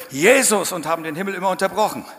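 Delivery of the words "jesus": loud, higher roared Jesus; roared name. Retold or imitated. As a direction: roar the name like millions before. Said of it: "gebrüllt". (gebrülltes Jesus)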